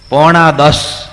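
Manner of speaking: deaf man blurting it out loud; loud shout. Retold or imitated. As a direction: shout the time like you can't hear yourself. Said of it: "આટલું જોરથી એવું કામ બોલે".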